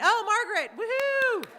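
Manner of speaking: loud, high happy whoop; excited cheer. Real, not acted. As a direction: cheer the winner's name. Winner named.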